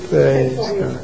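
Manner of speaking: ordinary delivery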